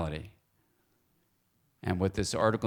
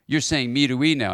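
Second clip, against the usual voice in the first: upset student; 5 semitones higher, 8 dB louder than usual. Retold or imitated. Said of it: "one of my students got really upset".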